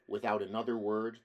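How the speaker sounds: plain reading voice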